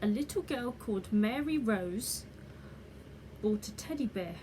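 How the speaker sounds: ordinary delivery, nothing marked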